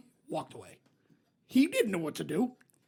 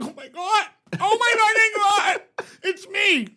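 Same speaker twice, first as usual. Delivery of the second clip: much higher, slower squeal of delight; mock gushing fan; 10 semitones higher, 5 dB louder, slower than usual. Audience member picked by the psychic, mock gushing squeal. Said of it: "And everyone's like".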